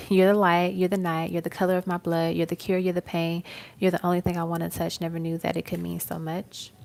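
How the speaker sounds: loud, heartfelt reading of love lyrics; dramatic lyric recitation. Acted. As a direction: declaim each line like a love poem.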